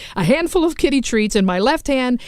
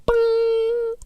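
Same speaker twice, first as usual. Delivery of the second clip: chime imitation; 14 semitones higher than usual.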